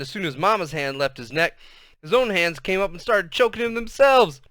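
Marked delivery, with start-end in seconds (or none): none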